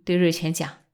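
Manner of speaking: plain reading voice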